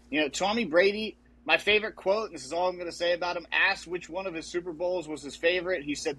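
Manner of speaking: ordinary talk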